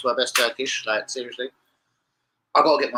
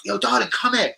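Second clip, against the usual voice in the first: mock catcall, higher pitched; calling out; 9 semitones higher than usual. Prankster hails a passer-by on camera, playful calling out. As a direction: pretend to hail a passer-by, voice lifted.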